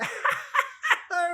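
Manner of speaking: high, loud shrieking cackle; cackling laugh. Acted. Charwoman laughing at the chance meeting, shrill cackle. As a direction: let a big coarse cackle rip.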